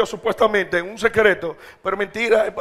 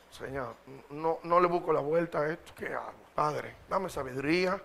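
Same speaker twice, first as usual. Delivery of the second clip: weak humble prayer; 3 semitones lower, 8 dB quieter than usual. Shown as a demonstration. Preacher on his sofa, weak before God, quietly pleading. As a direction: pray small and tired from the sofa. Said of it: "Cuando estoy débil ante él".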